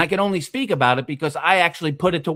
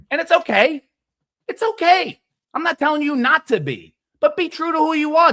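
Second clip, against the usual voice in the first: reassuring; 10 semitones higher than usual. Real, not acted. Reassuring after calling out.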